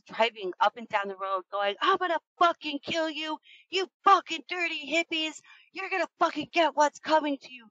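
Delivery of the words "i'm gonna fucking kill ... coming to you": high, hammed-up threatening rant; mock the killer's snarling threats; imitated furious threats. Angry driver hunting the hitchhikers from his truck, high mock rant.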